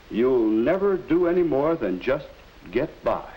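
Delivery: measured, earnest educational film voiceover; 1950s narrator. A narrator's reading. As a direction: narrate like an old classroom film.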